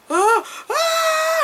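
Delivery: two high screaming cries of pain; mock pained screams. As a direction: scream like a sudden stab of agony. Man suddenly feels the pain.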